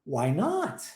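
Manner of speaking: "Why not?" starting low, swinging up on "not"; question intonation. Shown as a demonstration. Teacher models "Why not?" for the student, question intonation. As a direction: let "not" climb sharply as a question. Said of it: "Olha a entonação de pergunta".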